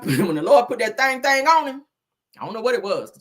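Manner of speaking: ordinary delivery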